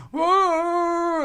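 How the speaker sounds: high wailing howl; crying dog howl. Retold or imitated. Speaker mocking complainers with the dog's wailing howl. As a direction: wail like the hurting hound. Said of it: "all they're doing is going".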